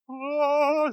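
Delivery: high, drawn-out blissful "ah"; mock Zen sigh. Counselor mocking a life in Zen state, blissful. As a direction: sing out a long mock-serene ah.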